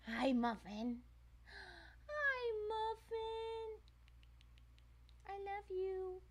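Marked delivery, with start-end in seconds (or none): 2.1-3.8 very high, slow, soft cooing. Real, not acted. Cooing to the cat. 5.3-6.2 very high, soft doting coo. Real, not acted. Cooing to the cat.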